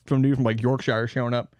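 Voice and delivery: usual speaking voice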